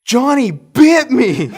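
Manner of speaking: high, loud, slow tattling yell; mock outraged accusation. Boy blaming his brother to mom, hammed-up cry.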